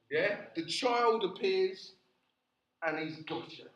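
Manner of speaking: ordinary talk, nothing marked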